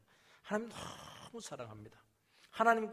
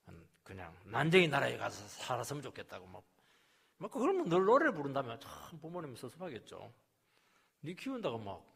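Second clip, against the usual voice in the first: usual speaking voice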